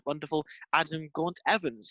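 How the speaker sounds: ordinary talk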